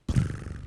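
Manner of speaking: rolled, voiceless trilled purr; imitated feline trill; cat's purr. A narrator's reading. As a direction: purr like a contented cat. Imitates the cat purring.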